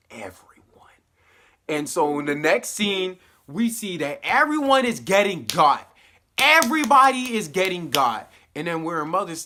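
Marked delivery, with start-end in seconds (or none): 0.1-1.0 whispered, much softer and lower; ominous whisper. Retold or imitated. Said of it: "And she was like". 3.5-8.3 much louder and higher, building; excited outburst. Real, not acted. Excited recap of massacre.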